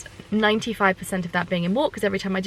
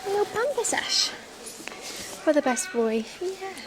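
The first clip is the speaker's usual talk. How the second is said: pet talk; 8 semitones higher, 3 dB quieter, much slower than usual. Real, not acted. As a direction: fuss over the horse. Pampering the horse, pet talk.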